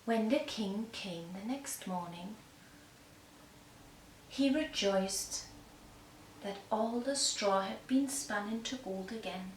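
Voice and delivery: usual speaking voice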